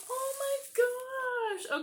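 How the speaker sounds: high, slow, amazed gasp; gasp of delight. Real, not acted. Spotting Jiminy on the Funko nose, high slow amazed gasp. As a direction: gasp and drag out oh my gosh.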